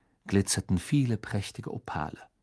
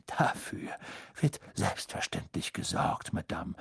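quiet polite headmaster; 5 semitones lower, 7 dB quieter than usual. A narrator's reading. Dumbledore bows.

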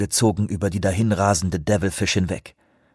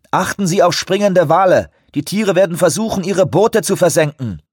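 over walkie talkie; 8 semitones higher, 4 dB louder than usual. A narrator's reading. Radio warning to ship.

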